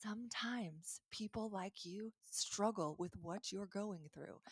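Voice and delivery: slow, breathy whispered comfort; soft whisper. Shown as a demonstration. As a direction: whisper it like a secret kindness.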